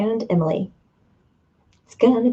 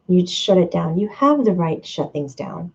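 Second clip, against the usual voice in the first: ordinary talk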